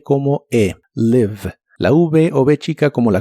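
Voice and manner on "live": "live" with a short clipped i, almost like e; "live" con i muy corta. Shown as a demonstration. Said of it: "La I de esta palabra se pronuncia de manera muy corta".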